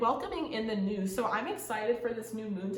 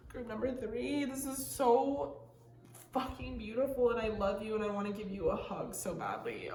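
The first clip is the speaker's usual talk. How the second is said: a real, soft, teary tenderness; close to tears; 4 dB quieter than usual. Real, not acted. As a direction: speak through welling tears of joy. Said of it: "Oh my God, I'm gonna cry".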